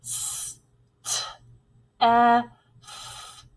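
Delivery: "S, T, E, F" as separate letter sounds, not names; sounded out. Shown as a demonstration. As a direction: segment "Steph" into four isolated sounds. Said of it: "Let's tap that out".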